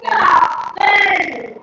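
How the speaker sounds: high, loud often; loud call. Shown as a demonstration. Teacher calling out the word for children to see.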